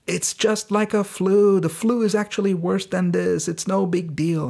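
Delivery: high, sing-along mock quoting; mocking dismissal. Podcaster quoting the president downplaying covid, high-pitched mockery. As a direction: parody someone brushing it off, pitched well up.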